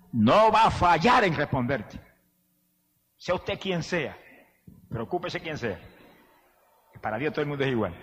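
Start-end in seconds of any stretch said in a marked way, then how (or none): 3.2-7.9 soft preaching. Real, not acted. Preacher quietly assuring the audience all are equal. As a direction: draw them close with a gentle hush.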